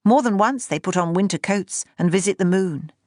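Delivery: ordinary delivery, nothing marked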